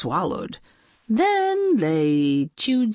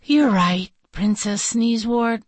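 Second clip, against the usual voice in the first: resigned princess, mildly raised voice; understated storybook weariness; sighing agreement; 6 semitones higher, 3 dB louder than usual. A narrator's reading. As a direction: concede it with a sigh. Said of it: "Princess Magnolia sighed".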